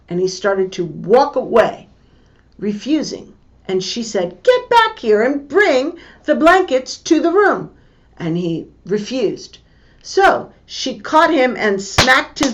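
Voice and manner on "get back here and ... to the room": higher, sharper mock parent command; mother ordering her son back; stern order. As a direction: call the child back firmly.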